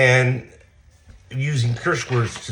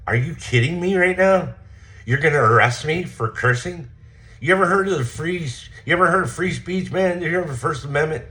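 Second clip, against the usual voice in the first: indignant retort; 10 semitones higher than usual. Retold or imitated. Speaker's heated retort retold.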